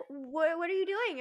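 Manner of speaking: put-on high, puzzled little girl voice. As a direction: ask it like a confused small child. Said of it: "She's like".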